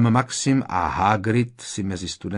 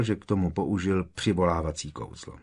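usual speaking voice

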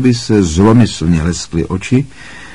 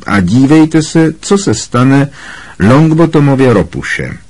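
raised, pitched-up teacher's voice; announcing to class; 6 semitones higher than usual. A narrator's reading. Snape inviting the class to watch the toad, announcing. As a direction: announce it to everyone, high and emphatic.

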